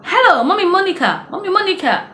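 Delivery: neighbour calling out. Retold or imitated. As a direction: call out urgently at the door. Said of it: "He came banging at my door".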